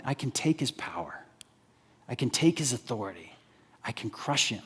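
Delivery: a soft, low, menacing boast; quiet gloating tyrant. Retold or imitated. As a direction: mutter it like a scheming tyrant. Nebuchadnezzar gloating over the king.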